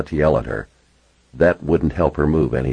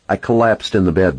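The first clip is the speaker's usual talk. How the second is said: usual speaking voice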